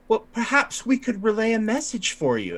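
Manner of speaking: high-pitched, halting politeness; high, hesitant conciliatory character voice; fussy ailing minister. Acted. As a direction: pick through the words delicately, voice way up. Same ailing minister speaks.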